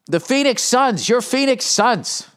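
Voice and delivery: announcer voice. Acted. Podcaster hyping the home team in a slow announcer call. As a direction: draw out Phoenix like a PA announcer.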